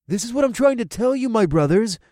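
high, loud, urgent cut-in; urgent interjection. A narrator's reading. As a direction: jump in, pressing the point. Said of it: "Boyd interjected".

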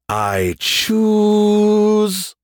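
drawn-out suspense, rising pitch; stretched anticipation. A narrator's reading. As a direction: hold the last word very long and high. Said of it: "stretching out the anticipation".

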